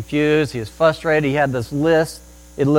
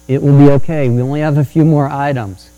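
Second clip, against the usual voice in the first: much louder, encouraging voice; loud reassurance; 10 dB louder than usual. Retold or imitated. Father heard from the other aisle, loud reassurance. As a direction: project firm encouragement.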